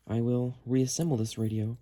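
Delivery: ordinary talk, nothing marked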